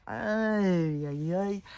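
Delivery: high, drawn-out wail of dismay. Retold or imitated. Imagining mattress stuffing, mock horrified wail. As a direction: cry out in mock horror.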